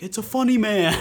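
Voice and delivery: put-on imagined child. Kids spotting a real Australian, high laughing child voice. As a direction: mimic an excited kid. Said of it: "they're gonna be like".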